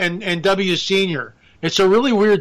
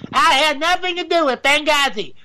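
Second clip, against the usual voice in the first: higher put-on Hillary voice; Hillary Clinton impression; 7 semitones higher than usual. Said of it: "I can't do Hillary Clinton".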